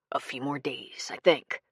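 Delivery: hushed conspiratorial whisper; soft secret murmur. A narrator's reading. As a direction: whisper so no one overhears. Secret plotting in the boathouse.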